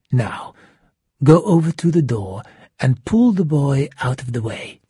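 controlled villain's command; ordering. A narrator's reading. Shark ordering Hero to move the boy, commanding. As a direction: give the order firmly in the menacing character voice. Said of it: "the shark ordered".

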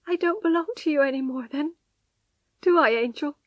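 high, helpless questioning. A narrator's reading. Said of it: "she asked helplessly".

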